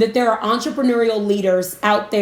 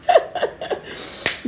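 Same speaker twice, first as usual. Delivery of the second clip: squeaky laugh; 3 dB quieter than usual. Real, not acted. Laughs at own impression.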